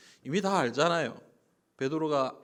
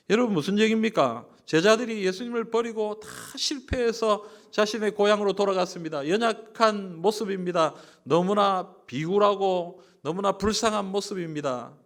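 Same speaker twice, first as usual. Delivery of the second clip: higher, louder preaching push; fervent preaching; 6 semitones higher, 6 dB louder than usual. Acted. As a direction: rouse the congregation with pathos. Preaching climax.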